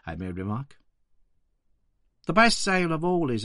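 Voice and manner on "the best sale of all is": a hint of a poor Victorian flower girl, higher; young London flower seller, lightly voiced, higher. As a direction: play the flower girl answering politely, higher. Flower girl's words voiced.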